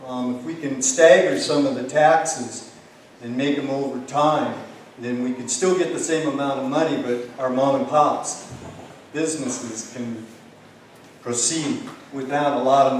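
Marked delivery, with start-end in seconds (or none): none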